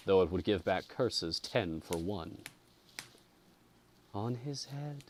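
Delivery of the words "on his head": soft, high, distant echo; the hall echoes again, softer and far; faint echo. A narrator's reading. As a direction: breathe it back faintly from far off. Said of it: "it repeated quite".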